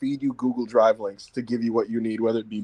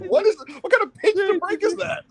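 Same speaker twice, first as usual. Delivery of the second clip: incredulous. Retold or imitated. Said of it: "I'm like".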